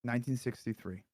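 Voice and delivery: soft, low-key solemn storytelling; hushed confession. Acted. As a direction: drop to a grave hush.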